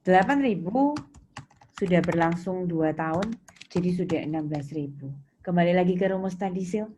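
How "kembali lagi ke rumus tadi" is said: usual speaking voice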